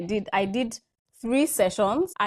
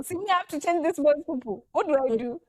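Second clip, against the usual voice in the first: higher-pitched, helpless plea; mock exasperation; 6 semitones higher than usual. Mother stuck mid-session with son's diaper, mock exasperation. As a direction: throw up the question in comic despair.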